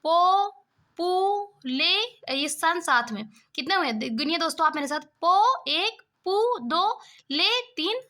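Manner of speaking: each syllable of population said apart and counted; teacher counting syllables one by one; syllables split. Shown as a demonstration. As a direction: break पो पू ले सन into separate beats. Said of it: "खंड करूँ इसके".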